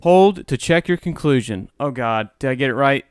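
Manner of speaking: ordinary talk, nothing marked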